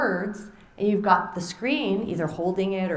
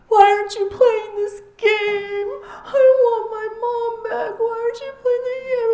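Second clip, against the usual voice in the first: imitated crying baby; 7 dB louder than usual. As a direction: wail like a baby wanting mom. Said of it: "And the baby starts crying".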